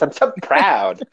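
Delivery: slow and drawn out, gushing and campy; a slow, campy put-on voice shown as a demo. Shown as a demonstration. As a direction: demo a lisping flamboyant caricature, stretched slow.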